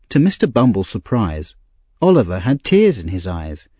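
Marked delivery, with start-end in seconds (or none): none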